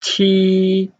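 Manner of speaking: Qi held long, high and level; level Mandarin tone on Qi, no fall; first tone. Shown as a demonstration. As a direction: hold Qi on one steady high note. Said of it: "first tone".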